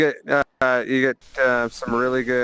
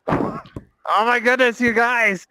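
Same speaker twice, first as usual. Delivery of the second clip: exasperated; 9 semitones higher than usual. Real, not acted. Exasperated, high.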